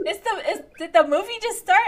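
loud, laughing shocked what. Real, not acted. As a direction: burst out in disbelief. Shocked the movie just started.

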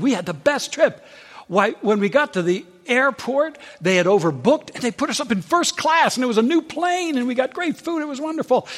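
high, gushing traveller; excited. Retold or imitated. Woman gushing to stylist about her trip, high and excited. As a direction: bubble over about the trip. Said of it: "she starts getting all excited".

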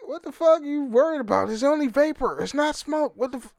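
high, fast, incredulous quoting; Greg Gutfeld. Retold or imitated. Quoting a TV host scoffing at vaping fears, high and fast. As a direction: toss it off like a pundit mocking the worry. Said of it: "And he was like".

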